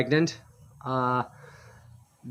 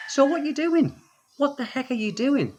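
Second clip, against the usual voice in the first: high-pitched, disbelieving retold challenge; exasperated, high-voiced query; incredulous questioning; 12 semitones higher than usual. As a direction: question him in mock disbelief, pitched well up. Retold questioning of the broke man.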